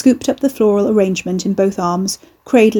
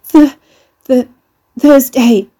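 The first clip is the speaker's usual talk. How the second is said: breathless; 5 semitones higher, 8 dB louder, slower than usual. Acted. Gasping victim answers weakly.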